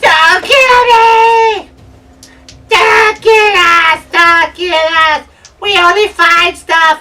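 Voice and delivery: very high, very loud, drawn-out creature shriek; slow, piercing goblin scream. Acted. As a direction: shriek for mercy as a panicked goblin. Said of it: "he sees you and he screams".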